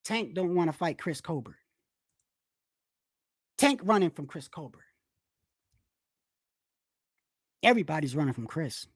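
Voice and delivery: a hint of a deadpan gossip voice; mock absurd claim. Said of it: "imagine if I said to you".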